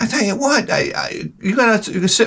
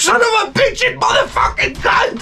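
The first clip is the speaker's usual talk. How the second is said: very high shouted mock rage; strangled high-pitched swearing fit; angry cursing. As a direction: imitate a furious man screaming curses. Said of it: "this angry handicapped guy".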